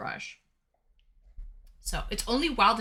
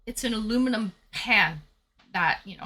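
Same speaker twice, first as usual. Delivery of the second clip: usual speaking voice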